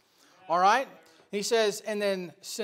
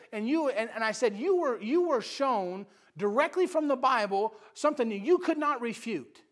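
normal talking voice

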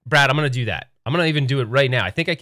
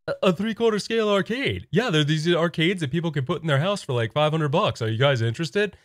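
dopey, hesitant pitchman, slightly raised; Patrick; 4 semitones higher than usual. Pretend. Arcade One Up pitching Nintendo, Patrick-like voice. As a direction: stumble through a timid sales pitch. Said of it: "sounds like Patrick from SpongeBob".

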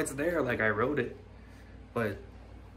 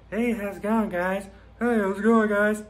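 higher, louder greeting voice; higher, louder hammed-up greeting; voice demo; 9 semitones higher, 7 dB louder than usual. Shown as a demonstration. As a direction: show off a new voice. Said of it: "I can talk differently too".

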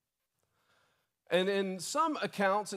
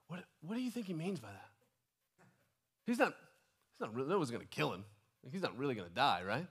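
softer, hushed puzzled aside; disciples leaning in; 3 semitones lower, 5 dB quieter, faster than usual. Retold or imitated. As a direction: lean in and murmur doubtfully. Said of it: "they would kinda lean in and go".

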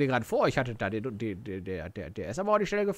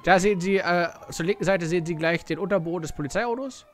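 mock sports commentator, raised voice; loud carnival barker; 5 semitones higher, 5 dB louder than usual. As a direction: announce it like a race commentator. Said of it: "Ich wollte ja früher immer mal Kommentator werden".

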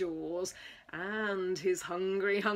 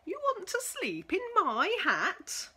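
horrified squeal; 9 semitones higher than usual. Acted. Pig horrified that the mouse wants his hat, squealing high. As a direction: squeal it in disbelief. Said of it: "squealed Hamilton, horrified".

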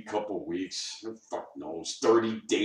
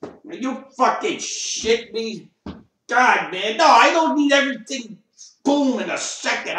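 loud outrage; 10 semitones higher, 7 dB louder than usual. Real, not acted. Outraged rant.

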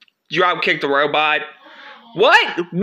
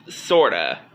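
much lower, flat machine voice; robot voice; 12 semitones lower than usual. Acted. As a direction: answer like a low droning robot. Robot answers.

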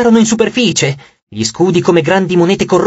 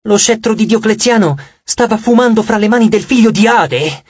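ordinary delivery, nothing marked

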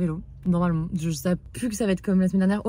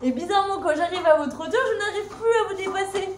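laughing; 10 semitones higher than usual. Real, not acted. Rant about men at the pool.

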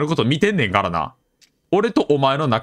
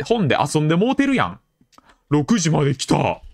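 ordinary talk

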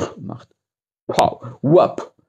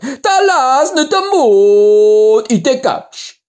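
reversed playback of speech; backwards speech; 10 semitones higher, slower than usual. Shown as a demonstration. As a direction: sound like a tape played backwards. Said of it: "machen wir das Verkaufen hier rückwärts".

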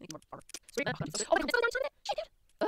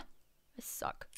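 much softer, voiceless self-reproach; dejected whisper; 15 dB quieter than usual. Real, not acted. Player berates self after a bad move, dejected whisper. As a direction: whisper it in defeat. Defeated after failing in game.

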